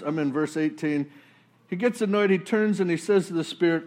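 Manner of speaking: ordinary delivery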